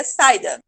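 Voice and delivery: "saída" wrongly as SAI-da, A and I in one syllable; said together. Shown as a demonstration. Teacher shows the wrong form, "saída" as SAI-da. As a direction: glide A into I in "saída", stress on the A. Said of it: "falar saída junto".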